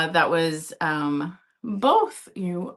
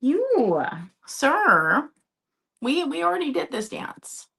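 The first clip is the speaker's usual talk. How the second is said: mock scolding; 7 semitones higher, slower than usual. Real, not acted. Scolding the cat playfully.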